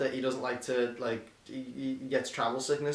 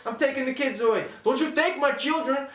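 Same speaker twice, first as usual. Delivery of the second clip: mock marriage fight; 9 semitones higher, 4 dB louder than usual.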